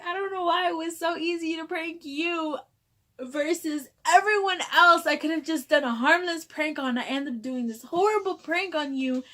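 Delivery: high, distressed retold wail; tearful mock confession. Prankster explaining herself to coworker, upset wail. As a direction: plead in an acted, upset high voice.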